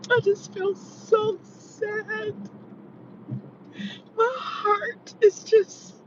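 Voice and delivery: high, sobbing through the words; real tears, voice breaking; talking while weeping; crying. Real, not acted. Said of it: "I need some tissue".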